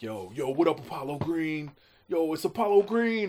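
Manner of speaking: playful mock stage intro with the new name; hype intro. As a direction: try the name out as a hype-man announcement. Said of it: "doing it a bunch of different ways".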